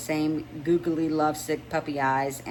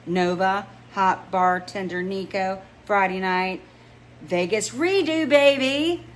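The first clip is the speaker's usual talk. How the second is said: slow, rising, louder playful tease; teasing excitement; 4 semitones higher, 5 dB louder, slower than usual. Acted. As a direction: sell it like a juicy surprise. Rennie teasing with big news.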